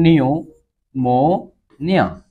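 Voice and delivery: Pneumonia very slow, with gaps between নিউ, মো and নিয়া; syllable by syllable. Shown as a demonstration. Teacher helping students avoid spelling mistakes, pneumonia very slowly syllable by syllable. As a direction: draw out and separate each syllable of pneumonia. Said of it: "syllable ভাগ করে করে পড়বা".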